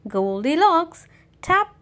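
ordinary delivery

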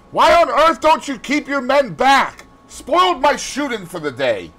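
loud, high, indignant scolding; irate outburst, loud at first and easing; angry. A narrator's reading. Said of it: "answered Sir Geoffrey angrily".

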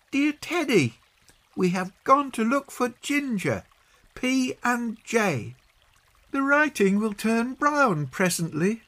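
put-on very high child's voice, dictating a note; Peggy. Peggy composing a leaf message for Teddy, high child voice. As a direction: pitch it right up, spelling out the message.

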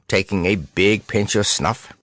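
ordinary delivery, nothing marked

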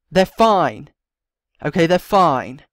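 loud, pressing reassurance; urgent insistence. Acted. Talking Tamara back from the edge, loud and urgent. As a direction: push the words hard to stop her.

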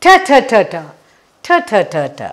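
put-on repeated t for young learners; phonics t sound. Shown as a demonstration. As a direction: drill the t sound for the class.

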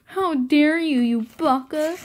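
hammed-up anime girl fury; tsundere outrage. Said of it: "She's just like".

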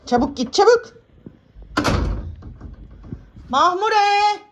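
loud, high hurry-up; urgent shooing. Real, not acted. Owner shooing the cat away, loud and urgent. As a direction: chase the cat off fast.

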